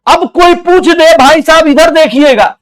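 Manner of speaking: high, loud call for attention. Acted. Teacher loudly calling the class to look here, high and loud. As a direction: shout across the room to grab them.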